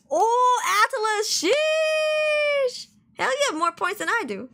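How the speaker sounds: high, loud surprised exclaim; a real burst of surprise; squeaky disbelieving sheesh. Real, not acted. Surprised at chat's points.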